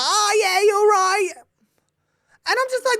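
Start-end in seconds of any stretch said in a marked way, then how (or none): 0.0-1.3 high, casual English greeting; James Corden. Acted. Mocking the host back home in England, casual English accent. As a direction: drop the proper accent for everyday English. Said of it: "you'll see him in England".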